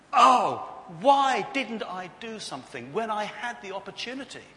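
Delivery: loud, high cry of regret; desperate regret. Acted. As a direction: wail it out in hammed-up anguish. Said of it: "desperate regret".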